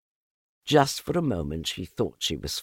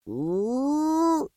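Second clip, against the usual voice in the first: high rising ooh; a high startled ooh. A narrator's reading. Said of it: "he said".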